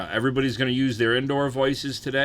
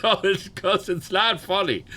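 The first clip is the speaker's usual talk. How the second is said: wheezing laugh rising high into protest; pained laugh; 8 semitones higher than usual. Real, not acted. Streamer laughing at his pain to chat, high wheezy laugh. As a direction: laugh while insisting it isn't funny. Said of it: "this is how I laugh when it happens".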